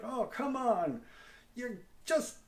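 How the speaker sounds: high, slow exasperated know-it-all; mock a fellow puppeteer scolding; impatient puppeteer viewer. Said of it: "you just be like".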